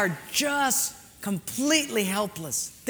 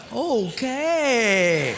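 long, louder drawn-out okay; mock gung-ho agreement; 3 semitones higher, 5 dB louder than usual. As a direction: stretch it out, playing the willing fool. Agrees to second foolish dive.

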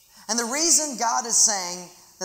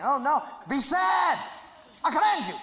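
higher, louder mock command; mock shout; 8 semitones higher, 4 dB louder than usual. Shown as a demonstration. Preacher shouting at laughing congregation, mock command. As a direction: bellow the order playfully. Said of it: "some of you are laughing".

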